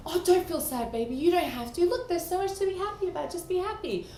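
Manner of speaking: high, loud, fast mock mother brushing it off; hammed-up fussing mum; overprotective mother. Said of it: "instead of saying".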